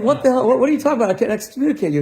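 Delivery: a rabbi staggered by an absurd request; incredulous. Retold or imitated. Rabbi retelling his shocked reply.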